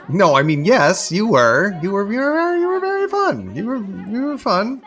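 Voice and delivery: rising into a flustered high squeal; flustered high squeak. Acted. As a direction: scramble to reassure her, pitch climbing. Flustered backpedaling to ex.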